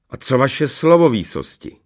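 insolent challenge, starting high and loud; bold, disrespectful reminder; cheeky. A narrator's reading. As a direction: throw it at the king with impudence. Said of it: "Připomněl mu drze Rumburak".

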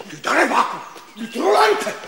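high, shouted string of insults; angry cursing. Acted. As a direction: hurl insults, rising to a furious high shout. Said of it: "že zase budete takhle neslušně nadávat".

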